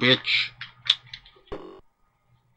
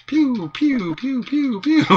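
high-pitched pew-pew laser shots; mock shooting sounds; 8 semitones higher, slower than usual. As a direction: spit out playful, repeated high pews. Imitating shots while playing.

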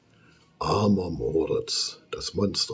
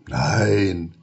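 flat refusal; 4 dB louder than usual. Acted. Monster refuses repeatedly.

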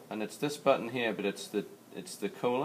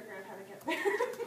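high little laugh; 13 semitones higher, 4 dB quieter than usual. Real, not acted. Laughs mid-demo.

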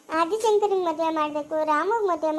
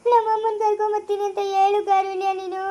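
crying plea. Acted. Daughter begging Karunya to bring mother back, crying. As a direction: wail the request through tears. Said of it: "ಹೆಂಗ್ ಅಳ್ತಾ ಇದ್ದಾಳೆ".

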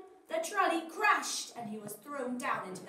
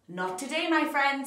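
louder, confident hero comeback; cool hero reply to the villain; heroic retort; 8 dB louder than usual. Acted. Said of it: "said Sweet Potato".

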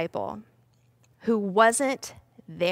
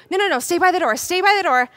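high, hurried command; acted panic, urging them to stay put; anxious order pitched high; urgent alarm; 6 semitones higher, 4 dB louder, much faster than usual. Acted panicked disciples guarding door.

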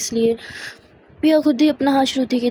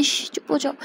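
soft shushing with a finger to the lips; hushing; 6 dB quieter than usual. Acted. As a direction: shush her, much softer. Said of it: "अपनी finger ऐसे lips पे रख के इशारा". (होंठों पर उंगली रखकर धीरे से चुप कराना)

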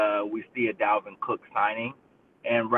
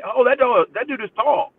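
very high, louder starstruck gasp; 12 semitones higher, 6 dB louder than usual. Retold or imitated. Co-host voices [name] seeing the draft bit, much higher and louder. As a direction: squeal it like a wowed fan, way up high.

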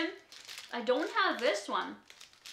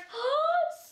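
high gasp; 14 semitones higher than usual. Real, not acted. Seeing infinity scarf.

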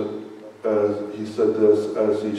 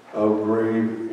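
ordinary delivery

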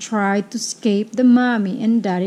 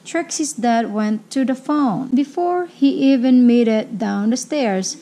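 ordinary delivery

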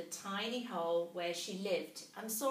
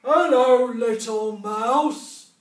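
very loud, slow booming bear; a huge bear rumbling a welcome; growling bear; 3 semitones higher, 20 dB louder, slower than usual. A narrator's reading. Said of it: "growled a huge bear".

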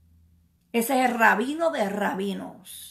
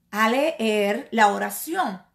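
ordinary delivery

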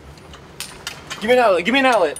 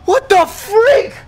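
high, loud panicked calls; alarmed yelling at the hiding dog; frantic high shouts; 9 semitones higher, 7 dB louder than usual. Real, not acted.

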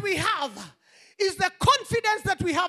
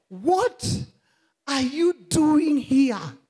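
slow, weighty question; 3 semitones lower, much slower than usual. Acted. God asking a second time.

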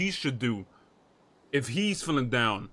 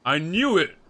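ordinary delivery

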